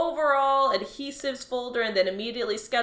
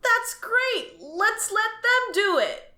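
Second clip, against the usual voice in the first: sarcastic delight, much higher and slower on the second line; mock enthusiasm; 11 semitones higher, slower than usual. Bored clerk mocking coworker's advice, sarcastic glee. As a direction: jump on it with fake joy.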